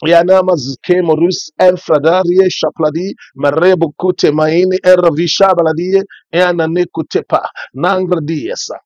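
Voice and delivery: rapid, fervent tongues; speaking in tongues. Acted. Pastor praying in tongues for the church, fervent. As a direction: let the prayer language flow.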